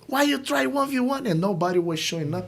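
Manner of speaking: high, scolding mock blame; blaming coach. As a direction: tell off the kid unfairly. Coach blaming kid angrily.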